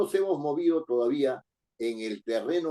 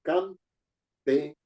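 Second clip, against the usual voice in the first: campeón split into cam, pe, ón with clear gaps; syllable by syllable; 2 semitones higher than usual. Shown as a demonstration. Teacher counting the three syllables of campeón, broken apart. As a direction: pronounce campeón slowly, one syllable at a time. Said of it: "tengo tres sílabas".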